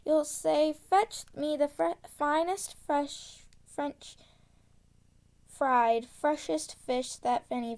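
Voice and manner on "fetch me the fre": plain reading voice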